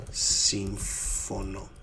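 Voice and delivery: συμφωνώ stretched very slowly; slow syllable-by-syllable model. Shown as a demonstration. Teacher showing "I don't agree", word sounded out slowly. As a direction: draw out συμφωνώ piece by piece.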